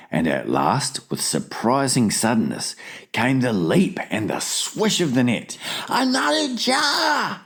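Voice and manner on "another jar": high, drawn-out excited call; urgent cry. A narrator's reading. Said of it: "he cried".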